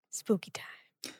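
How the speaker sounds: breathy ghost-story whisper; spooky whisper. Acted. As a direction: whisper it eerily, slow. Halloween food, whispered aside.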